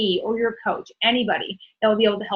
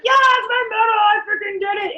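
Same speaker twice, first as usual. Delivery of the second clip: higher and louder, bursting with pride; proud excitement; 10 semitones higher, 3 dB louder than usual. Retold or imitated. Runner showing off a marathon medal, excited and proud. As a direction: crow about your finisher's medal. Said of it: "You're like".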